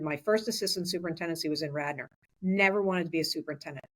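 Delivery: usual speaking voice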